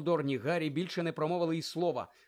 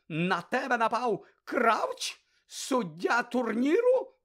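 slower, higher, louder incredulous shout; outrage rising to a yell; building outrage; 9 semitones higher, 6 dB louder, much slower than usual. Acted. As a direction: hammer the question, escalating. Karkaroff outraged questioning.

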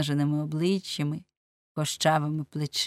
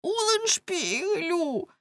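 plaintive calling; 12 semitones higher, slower than usual. A narrator's reading. Man calling his lost friend for rescue, plaintive wail. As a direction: wail the name pitifully. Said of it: "знову жалібно гукнув Ламме".

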